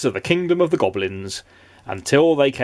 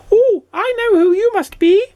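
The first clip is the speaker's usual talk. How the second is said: loud, very high goblin squeak; 6 dB louder than usual. A narrator's reading. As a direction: pipe up like a cheeky little goblin. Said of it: "said the goblin".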